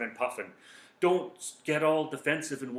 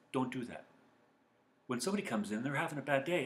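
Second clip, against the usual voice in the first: usual speaking voice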